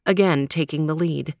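ordinary delivery

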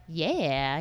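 low, soft, savoring murmur; mock into-it approval. The one girl who was into it, low approval. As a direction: imitate someone quietly enjoying it.

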